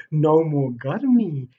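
loud, very slow relieved drawl; slow content camel. Acted. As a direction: stretch out the relief. Horses relieved.

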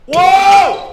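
loud high wow; excited cheer. Real, not acted. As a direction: shout the wow at a big play. Cheer at a big play.

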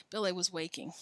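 plain reading voice

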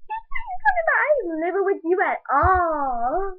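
shrieking, outraged shout, high and loud; furious shout. Acted. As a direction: blast him with disbelief, near tears. Daughter yells at estranged father.

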